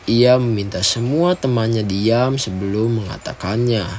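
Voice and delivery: ordinary delivery, nothing marked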